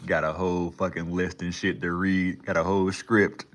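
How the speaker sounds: loud, formal news anchor read; newscaster voice. Pretend. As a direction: announce it like a newscaster. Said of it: "sound like a fucking, a newscaster".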